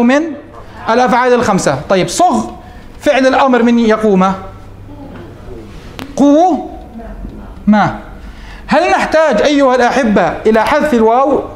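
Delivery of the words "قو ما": "قو ما" with a long held first syllable and a long pause; broken into syllables. Shown as a demonstration. Teacher forming the imperative for students, syllable by syllable. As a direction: stretch "قو", wait, then add "ما" separately.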